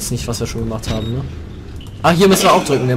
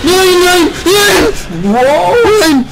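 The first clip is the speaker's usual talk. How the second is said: panicked screaming; 6 dB louder, much slower than usual. Real, not acted. Caught by monster in game.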